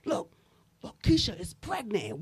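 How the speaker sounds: hushed hallway gossip, breathy and secret; gossiping whisper. Retold or imitated. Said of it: "being like".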